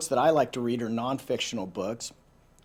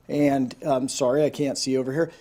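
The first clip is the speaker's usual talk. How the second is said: ordinary delivery, nothing marked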